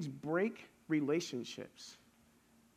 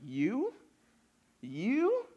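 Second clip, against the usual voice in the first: rising, much higher "You? You?" in mock shock; incredulous disbelief; 5 semitones higher than usual. Friends doubting the changed pastor, rising in disbelief. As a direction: toss it up like you can't believe it. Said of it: "And they're like".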